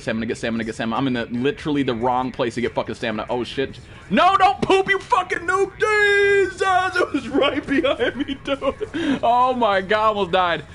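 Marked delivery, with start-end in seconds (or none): 4.1-7.0 shrieking high in real panic; panicked shriek. Real, not acted. Gamer shrieking at a noob teammate while nearly dying, panicked. Almost killed, panicked yelling. 7.0-10.6 laughing high after a close call; shaky relieved laugh. Real, not acted. Streamer laughing in relief after nearly dying, breathless.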